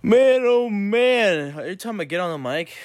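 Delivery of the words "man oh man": high, slow, stretched man oh man; mock-dramatic sigh of a greeting; drawn-out groan. Real, not acted. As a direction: sing it out long and high. Vlog opener, stretched exclamation.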